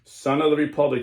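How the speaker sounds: loud mysterious summons. Acted. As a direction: summon him grandly. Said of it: "said the same mysterious voice".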